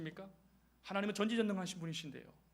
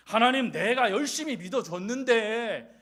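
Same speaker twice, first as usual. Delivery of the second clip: mock complaint to God; 3 semitones higher, 9 dB louder than usual. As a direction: argue with God as if cheated. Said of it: "이렇게 따지는 거예요".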